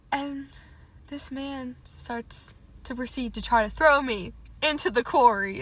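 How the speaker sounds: normal talking voice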